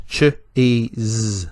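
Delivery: Ch, E, Z as three separate sounds; sounded out. Shown as a demonstration. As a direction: segment Ch, E, Z, buzzing the Z. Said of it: "three sounds".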